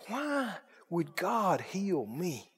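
slow, soft, choked-up wondering; tearful quiet question. Retold or imitated. Broken man asking the preacher, slow tearful question. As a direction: ask it as a broken man near tears.

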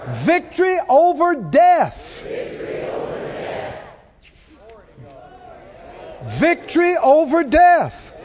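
high, ringing pulpit proclamation; triumphant preaching. Real, not acted. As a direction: proclaim victory to the whole congregation.

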